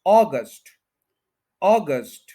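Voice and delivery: August as AW-gust, strong start, falling; first-syllable stress. Shown as a demonstration. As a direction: punch "AW" in August and drop "gust". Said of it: "first के ऊपर जो है वो stress है". (अगस्त में "ऑ" पर ज़ोर, "ऑ-गस्ट")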